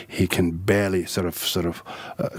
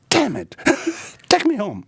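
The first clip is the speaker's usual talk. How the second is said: mock upset prince. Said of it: "He said".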